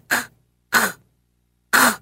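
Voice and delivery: three separate hard "k" clicks; English "k" version. Shown as a demonstration. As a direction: try out "k" as a bare consonant, spaced out. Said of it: "the English version would be like".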